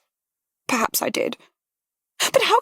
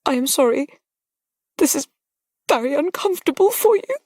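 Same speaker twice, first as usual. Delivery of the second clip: put-on higher, tearful young woman breaking down; young lady close to sobbing, higher; choked with tears; 8 semitones higher than usual. A narrator's reading. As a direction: let her crumble toward tears. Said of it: "Her utterance became choked by tears".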